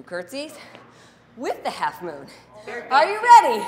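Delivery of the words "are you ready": loud, high hype call to the class; excited call. Real, not acted. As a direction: fire up the room. Coach hyping the class.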